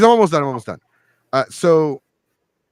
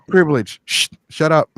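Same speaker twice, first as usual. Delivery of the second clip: mock scolding; 4 semitones higher than usual. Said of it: "I'm like".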